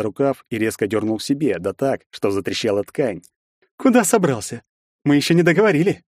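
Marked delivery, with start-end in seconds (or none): 3.8-6.0 aggressive challenge. A narrator's reading. Bully grabs hero leaving.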